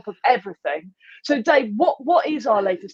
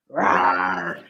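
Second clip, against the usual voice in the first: low growly rawr; dinosaur roar. Pretend.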